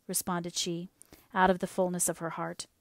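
plain reading voice

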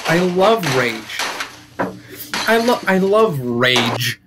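slow, fond put-on dad voice; a lightly voiced dad, slow and doting; imitating dad. Dad telling his son about Rage, slow fond imitation.

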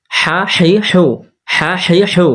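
teacher chanting হ্যাঁ হেই হেউ; drill of vowel signs. Shown as a demonstration. (হ্যাঁ হেই হেউ সুর করে পড়া)

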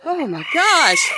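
high, loud, drawn-out gasp; startled exclamation. Real, not acted. Hen lunges at her, slow high startled gasp. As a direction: let it stretch out in alarm.

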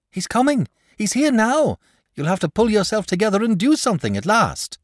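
high, loud urgent shout. A narrator's reading. Boy shouting a warning to the dragon. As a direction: yell the alarm from afar. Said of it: "he shouted".

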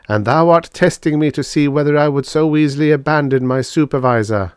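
high, loud, level intoning; solemn robot. A narrator's reading. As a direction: recite it like a devout machine. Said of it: "said the robot solemnly".

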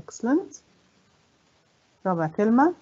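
ordinary delivery

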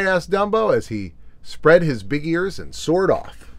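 ordinary delivery, nothing marked

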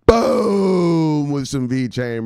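slow, stretched and loud; drawn-out hype. Real, not acted. Host hyping brothers on V-Chain, slow loud drawn-out call. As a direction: hold the boom out long.

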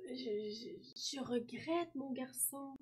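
soft, slow, faltering apology; old tree apologising softly, word by word; halting regret. A narrator's reading. Worn-out stump apologises to the boy. (regret hésitant, doux et lent)

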